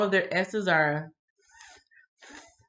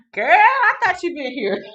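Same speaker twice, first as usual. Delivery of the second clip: high, loud exclamation; real surprise; 12 semitones higher, 10 dB louder than usual. Real, not acted. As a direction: exclaim it high-pitched in amused disbelief. Repeats surprise at late arrival.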